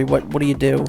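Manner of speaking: ordinary delivery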